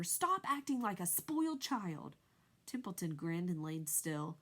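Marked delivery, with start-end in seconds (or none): 0.0-2.2 angry shout. A narrator's reading. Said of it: "screamed Wilbur".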